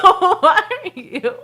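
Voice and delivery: slow, laughing question; can barely get it out for laughing. Real, not acted. Asking about dad's microwave, laughing helplessly.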